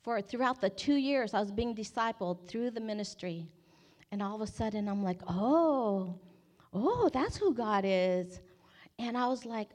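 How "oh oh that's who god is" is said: dawning realization. Retold or imitated. Acting out sudden realization.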